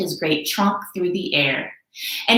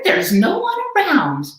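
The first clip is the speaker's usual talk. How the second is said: higher, puzzled elephant voice; Horton puzzled; 9 semitones higher, 3 dB louder than usual. Acted. Horton to himself at the pool, higher and puzzled. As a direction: wonder aloud as Horton. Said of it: "thought Horton".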